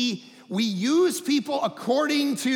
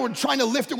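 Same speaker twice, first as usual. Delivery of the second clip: ordinary talk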